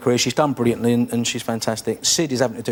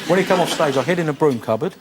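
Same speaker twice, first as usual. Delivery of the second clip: ordinary talk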